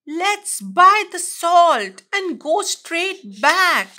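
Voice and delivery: lightly scared high quacking plea, slow; a frightened duck begging to go home. A narrator's reading. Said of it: "and quacked".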